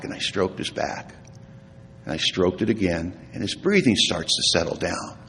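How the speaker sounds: hushed, softened storytelling with long pauses; tender. Retold or imitated. Speaker recalls soothing his dying father-in-law to sleep, hushed tenderness. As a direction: let the voice settle gently, phrase by phrase.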